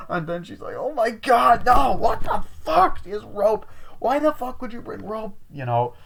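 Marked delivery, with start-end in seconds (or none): 0.8-5.4 high, loud, drawn-out horrified squeal; hammed-up panic at the rope; girl panicking over the rope, high and loud; mock freak-out. Finding rope in his bag.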